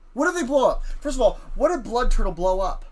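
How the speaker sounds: usual speaking voice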